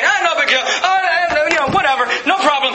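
hammed-up careless shrug; dismissive shrug. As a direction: lampoon someone waving sin away. Mocking a careless reaction to sin.

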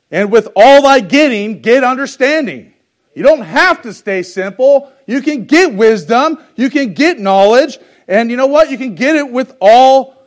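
loud, rising preacher's cry; preaching at full force. Acted. Preacher urging the congregation to get wisdom, loud and rising. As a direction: swell it up to the back pew.

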